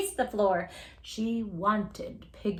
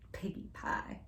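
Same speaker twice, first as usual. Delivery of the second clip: unvoiced, hushed piggy pie; whispered hungry witch; whispered craving; 13 dB quieter than usual. Shown as a demonstration. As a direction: breathe it like a secret treat. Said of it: "Think of something really yummy to eat?".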